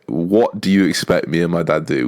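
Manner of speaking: ordinary delivery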